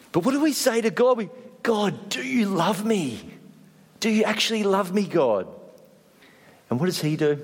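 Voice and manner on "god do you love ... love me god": doubting plea. Acted. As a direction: question God as if unsure. Acts out doubting plea to God.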